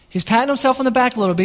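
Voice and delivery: rattled off fast; fast throwaway repeat; quick repeat. Shown as a demonstration. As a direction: toss the line off quickly.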